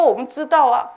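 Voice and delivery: ordinary talk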